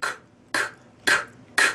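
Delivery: "ک" as a crisp whispered click; rimshot drill. Shown as a demonstration. Teacher drilling rimshots for students in crisp clicks. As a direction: tap "ک" like a stick on the rim. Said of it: "با کا تمرین کنیم".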